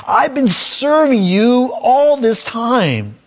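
high, slow mock complaint; complaining. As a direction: whine it like a sulking older son. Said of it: "complaining about".

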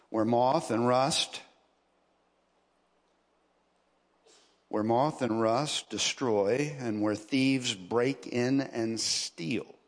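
slow, low, measured verse reading; scripture reading. Acted. Preacher reading the verse on moth and rust, slow scripture reading. Reads scripture aloud to congregation.

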